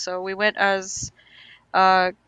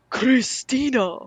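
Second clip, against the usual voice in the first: drawn-out, higher anime-style call of the name; Okarin impression; 4 semitones higher, 4 dB louder, much slower than usual. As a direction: imitate an anime character calling out, slowly stretched. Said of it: "that's how Okarin says it".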